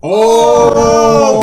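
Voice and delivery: loud, high whoop of approval; excited cheer. Real, not acted. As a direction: erupt in delighted agreement. Cheers the air fryer answer.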